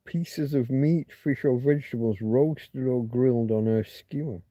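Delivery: normal talking voice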